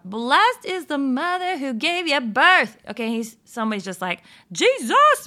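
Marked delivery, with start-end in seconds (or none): 0.0-2.8 loud, higher call of praise from the crowd; yelling from the crowd. Retold or imitated. A woman in the crowd yells to Jesus, loud high call. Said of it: "someone yells out in the crowd". 4.5-5.2 high, loud holler of the name. Retold or imitated. A woman in the crowd hollers for Jesus, high and loud. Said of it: "He's hollering out".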